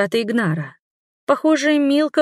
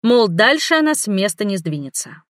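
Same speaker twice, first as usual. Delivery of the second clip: ordinary delivery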